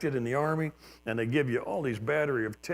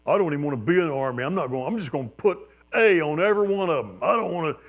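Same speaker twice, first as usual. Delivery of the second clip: griping draftees; 4 semitones higher, 6 dB louder, faster than usual. Retold or imitated. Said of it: "I heard guys in there saying".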